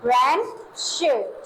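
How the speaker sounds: रेंशर drawn out very slowly; teacher models रेंशर slowly for students; slow model reading. Shown as a demonstration. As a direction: spell out रेंशर slowly for the class. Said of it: "क्या पढ़ेंगे?".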